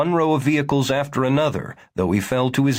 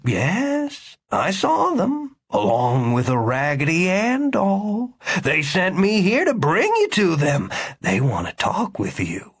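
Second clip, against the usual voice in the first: humoring doctor; 7 semitones higher than usual. A narrator's reading. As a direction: coax him to come along.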